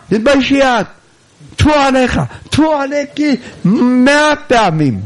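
high-pitched indignant marital quarrel; acted shouting match. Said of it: "וכל הלילה ממטירים צעקות". (ריב זוגי משוחק בקול גבוה)

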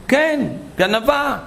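very high, slow, drawn-out accusation; scornful accusation. Retold or imitated. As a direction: drag out the word thief with contempt. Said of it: "צעקה עליה".

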